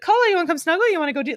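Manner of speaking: high sing-song voice for the dog; sweet dog talk. Retold or imitated. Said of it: "And I was like".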